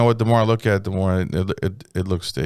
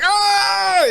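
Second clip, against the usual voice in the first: mock yelp. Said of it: "He's like".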